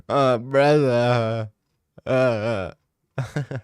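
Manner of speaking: put-on very slow Dagestani accent braza; Islam impression. Streamer's slow Islam impression for chat, Dagestani accent. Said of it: "It's my best Islam impression".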